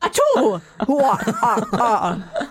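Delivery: Dracula-style staccato laugh; hammed-up vampire count. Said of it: "It's more like Dracula".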